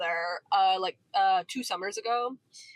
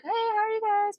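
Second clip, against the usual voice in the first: high bright shop greeting; chirpy sales-clerk hello to customers; customer service welcome; 10 semitones higher than usual. Retold or imitated. Store clerk greeting couple walking in, cheery high welcome. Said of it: "You do the customer service welcome".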